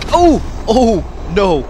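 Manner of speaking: sudden high, loud cry of shock; loud high startled shout; startled yell. Real, not acted. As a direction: yelp as you get hit. Startled in fight.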